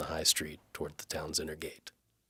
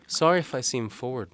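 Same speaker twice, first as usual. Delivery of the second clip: higher, louder lightly voiced young woman; Galena's voice; 9 semitones higher, 8 dB louder than usual. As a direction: voice the maid brightly and politely. Galena apologises.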